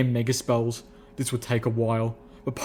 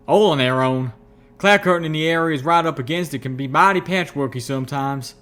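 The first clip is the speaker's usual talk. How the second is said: loud, higher-pitched country talker; Calamity's folksy voice; 3 semitones higher, 5 dB louder than usual. A narrator's reading. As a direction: explain it with hearty rustic swagger. Voices Calamity explaining the clouds.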